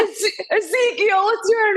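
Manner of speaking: high, slow laughing dog voice; goofy dog answering, giggling. Retold or imitated. Second dog in the video, high goofy voice. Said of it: "And he's like".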